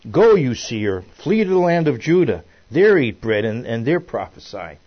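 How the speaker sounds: loud, forceful dismissal read from scripture; commanding scripture reading. A narrator's reading. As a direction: proclaim the priest's order to leave. Reads priest's command from scripture.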